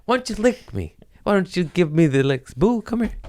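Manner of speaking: put-on high pleading baby talk to the cat. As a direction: coax the cat sweetly.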